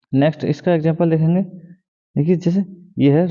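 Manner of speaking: ordinary talk, nothing marked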